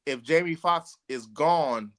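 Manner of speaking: normal talking voice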